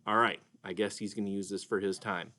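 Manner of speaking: normal talking voice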